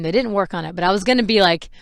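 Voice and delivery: ordinary talk, nothing marked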